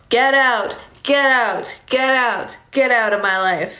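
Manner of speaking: yelling. Acted. Johnny yells after Lisa as she slams the door. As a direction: throw her out with each yell. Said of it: "Johnny is yelling".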